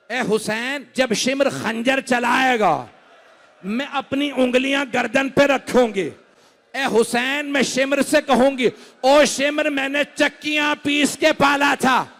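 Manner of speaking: rising from firm to a high, loud shout; building lament. Acted. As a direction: climb to a full cry against Shimr. (बढ़ती हुई ऊँची, ज़ोरदार पुकार)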